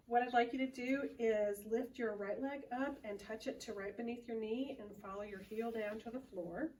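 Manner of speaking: ordinary delivery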